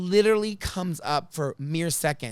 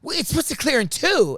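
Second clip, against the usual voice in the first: higher, louder know-it-all reply; snippy clerk; 9 semitones higher, 7 dB louder than usual. Retold or imitated. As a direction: correct her like a smug clerk. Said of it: "And she goes".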